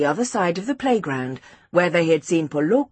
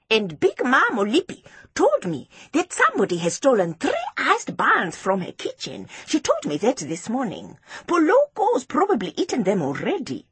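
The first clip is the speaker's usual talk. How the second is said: put-on raised, insistent child's voice; high-pitched, indignant pupil; Seppo, cross schoolboy accuser; 7 semitones higher than usual. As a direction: voice a boy telling on a classmate.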